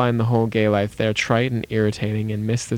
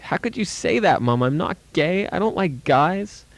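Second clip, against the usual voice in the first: upset protest; 7 semitones higher than usual. Retold or imitated. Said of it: "Lose it. Start yelling at her".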